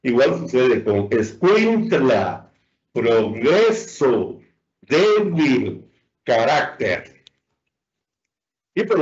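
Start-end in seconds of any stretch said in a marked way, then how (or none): 1.1-2.5 escuintla slowly, leaning on "cuin"; stress on penultimate syllable. Shown as a demonstration. Teacher gives another example, escuintla stressed on "cuin". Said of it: "la penúltima sílaba". 2.9-7.2 progreso, débil, carácter slowly, weight on "gre", "dé", "rác"; stress on penultimate syllables. Shown as a demonstration. Teacher lists examples, progreso, débil, carácter slow and stressed before last. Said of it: "cuya penúltima sílaba es la tónica".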